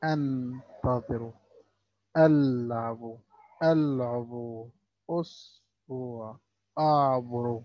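أنتظر ألعب with the letter after the alif-lam held silent; slow, clipped sukun letters; sukun demo. Shown as a demonstration. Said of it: "ساكن". (نطق الحرف الساكن)

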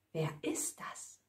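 whispered, barely audible question; hushed suspense. A narrator's reading. Narrator to listeners after the knock at the door, whispered. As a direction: whisper it as if afraid to be heard.

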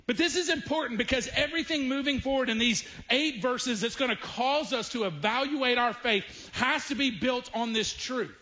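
ordinary talk

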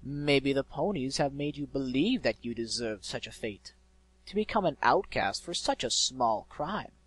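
loud, grandly persuasive villain; insinuating eloquent villain. A narrator's reading. Mysterious voice stirring the griffin's grievance, insinuating villain. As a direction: work on the listener, slowing toward the end.

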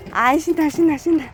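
high, bouncy cheering chant; excited cheering. Real, not acted. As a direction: cheer the dogs on happily. Cheering along with playing dogs.

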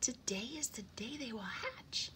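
high, hushed-thrill sparrow voice; thrilled mother bird; excited. A narrator's reading. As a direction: announce it high, with breathy excitement. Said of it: "cried the shy little sparrow excitedly".

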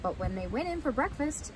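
ordinary delivery

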